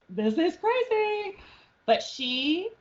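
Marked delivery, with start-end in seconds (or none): 0.1-1.4 high, slow, drawn-out amazement; stunned disbelief. Retold or imitated. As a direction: stretch it out like a kid who can't believe it. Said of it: "I remember just being like".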